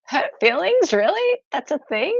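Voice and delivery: can't believe this is real; incredulous. Retold or imitated. Telling a friend about barristers, incredulous and high. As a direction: pitch shoots way up, loud and disbelieving. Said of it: "And I'm like".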